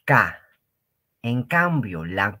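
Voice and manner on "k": K said "ka" as in Spanish. Shown as a demonstration. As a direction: say "K" as an ordinary Castilian ka. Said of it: "Como en castellano". (ka, como en castellano)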